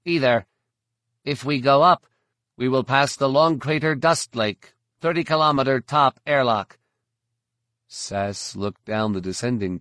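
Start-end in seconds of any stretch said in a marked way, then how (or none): 0.1-6.8 higher and louder, a chirpy alien guide; high insectoid alien voice. A narrator's reading. Verpine guide answering the bounty hunter, high and eager. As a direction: pitch it up like an eager insect creature.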